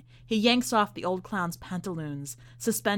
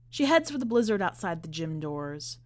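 usual speaking voice

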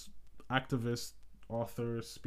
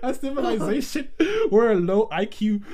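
laughing mock quote; 13 semitones higher, 9 dB louder than usual.

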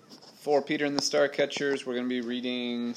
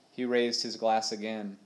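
plain reading voice

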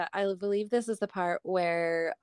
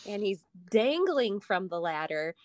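ordinary talk, nothing marked

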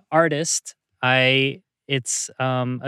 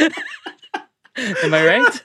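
laughing; 9 semitones higher, 4 dB louder than usual. Real, not acted. Laughing while guessing the member.

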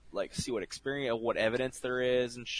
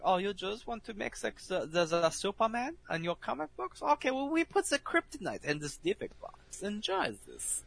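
mock German scientist; 9 semitones higher than usual. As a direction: play a smug Nazi scientist handing over a gift. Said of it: "the Germans over there, like".

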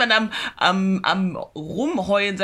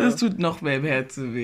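lower, mock-tragic repeat; a theatrical lament, much lower than before; mock heartbreak; 6 semitones lower than usual. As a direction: ham up the heartbreak, pitched low.

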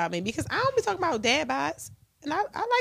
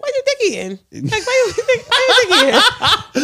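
imitating a suspicious man; 10 semitones higher, 7 dB louder, slower than usual. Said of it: "He gonna be like".

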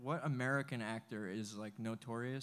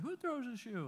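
high-pitched movie catchphrase impression; Austin Powers; 12 semitones higher than usual. Explaining the actor's movie scene, high-pitched impression. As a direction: mimic the film spy's indignant question, pitched up high. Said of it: "you know, Austin's like".

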